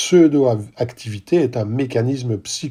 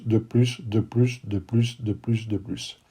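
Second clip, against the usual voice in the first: each "de plus" softer until it dies away; fading repetition; 2 semitones lower, 8 dB quieter, slower than usual. Acted. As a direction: let the repeated words fade out. Repeating "more" fading to nothing. (répétition qui s'éteint peu à peu)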